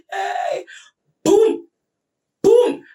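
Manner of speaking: loud high booming thuds, hammed up; retold bangs of a head on a wall; mock impact booms. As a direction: slam out each boom like a blow landing. Imitating the blows to head.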